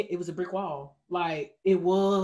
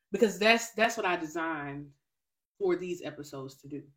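ordinary talk

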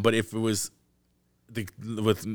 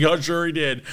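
sarcastic quote; 8 semitones higher, 5 dB louder than usual. Retold or imitated. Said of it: "He's like".

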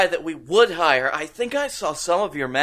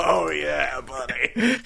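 lower, softer voice as Vince; a lightly voiced smug boss; Vince McMahon; 3 semitones lower, 4 dB quieter, slower than usual. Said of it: "He's like".

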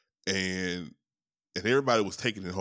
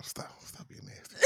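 whispered scold; 18 dB quieter than usual. Acted.

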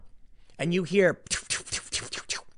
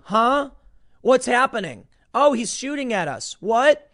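higher, louder startled confusion; mock bewildered; 8 semitones higher, 4 dB louder, slower than usual. News crew under pepper balls, mock bewildered cries. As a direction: play a dazed, clueless reporter. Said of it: "they're going".

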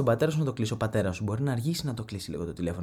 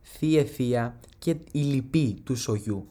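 slow and solemn, like a speech; mock-solemn family speech; slow formal address; slower than usual. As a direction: address the family slowly and gravely. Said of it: "Και έχω να πω".

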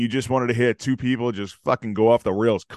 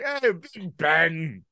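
slow, drawn-out British hey; mock British accent; 5 semitones higher, much slower than usual. Imagining the English greeting Big Ben, slow British accent. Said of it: "They wake up like".

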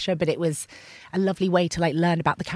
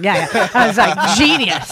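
loud, laughing, higher yes; delighted agreement; 4 semitones higher, 9 dB louder than usual. Retold or imitated. Answering Nick Love, laughing and loud with delight. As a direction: gush agreement through laughter.

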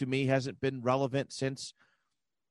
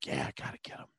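hushed mock Colts fan, much softer; 11 dB quieter, faster than usual. Podcaster mimicking Colts fans joining in, Colts fan. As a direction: drop low and toss it off as a fan. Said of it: "Colts fans are just like".

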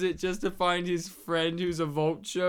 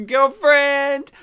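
sassy; 7 semitones higher, 11 dB louder than usual. Retold or imitated. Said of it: "this sussy look like".

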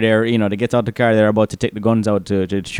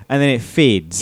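usual speaking voice

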